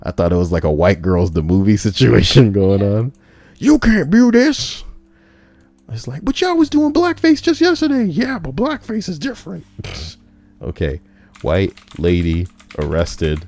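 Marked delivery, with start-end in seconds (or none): 3.6-4.8 high, slow put-on voice; mock high voice; mock announcement. 6.2-8.0 high put-on voice; mock high-pitched accuser; mock complaint. 8.0-9.7 high put-on voice, brushing it off; mock high-pitched defender; mock reply.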